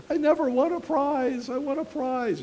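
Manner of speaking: high, gleeful mock brag; mock excitement. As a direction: squeal it like a thrilled prize winner. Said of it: "Some people are like".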